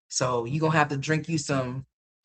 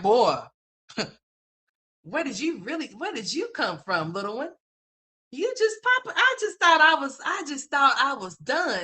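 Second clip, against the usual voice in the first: baffled mom; 8 semitones higher than usual. Retold or imitated. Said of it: "I was like".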